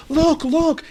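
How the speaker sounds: high, loud cry to point something out; excited screaming. A narrator's reading. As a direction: scream it to get everyone looking. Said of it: "he starts screaming".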